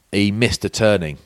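usual speaking voice

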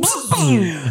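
high bee buzz. Retold or imitated. Game master re-enacts bee's insult, high buzzing. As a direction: buzz it to go with the gesture.

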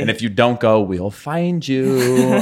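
slow, drawn-out playful menace; mock threat. Podcaster to the team about mandatory participation, mock threat. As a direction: stretch each word out as a joke warning.

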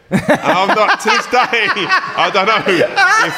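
can barely get it out for laughing. Real, not acted. Still laughing at the pub joke.

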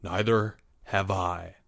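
low, slow, quiet gravity; grave. A narrator's reading. Mister M agreeing at the window, grave voice. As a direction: say it slowly and gravely. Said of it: "his voice grave".

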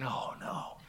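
whispered oh no; soft unvoiced whisper of dismay; whispered dread. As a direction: breathe it out in dread. Inner dread in story.

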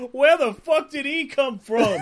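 high-pitched mock acting outburst; Dick Ritchie audition reading. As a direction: read it like an overeager auditioning actor. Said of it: "and he just goes".

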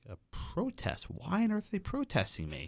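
high, amused disbelief; incredulous. Retold or imitated. Told of a protest against him, laughing in disbelief.

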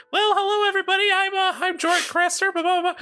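high, bright-pitched fantasy character voice; Jorik's first voice. Retold or imitated. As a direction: pitch way up for a goofy new character. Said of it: "he just came out and he goes".

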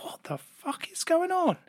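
high, hushed, drawn-out disbelief; bewildered. Retold or imitated. Retelling stumbling on a maid in the bathroom, hushed bewilderment. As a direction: retell it softly and slowly, pitched high in bewilderment.

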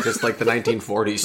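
ordinary delivery

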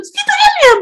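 put-on a kid answering simply; daughter's answer. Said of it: "daughter's answer". (sagot ng bata, mataas at malakas)

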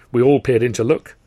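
plain reading voice

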